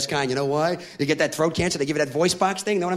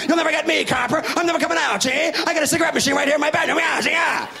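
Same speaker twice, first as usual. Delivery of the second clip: gangster holdout; 8 semitones higher than usual. Acted. Smoker defying the cops, gangster-style shouting. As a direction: yell it like a cornered gangster.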